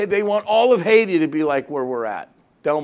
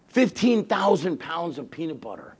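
usual speaking voice

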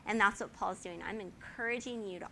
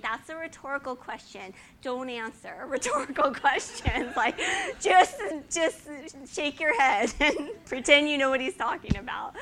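loud, high, laughing mother's advice; laughing explanation; 4 semitones higher, 9 dB louder than usual. Retold or imitated. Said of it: "I explained".

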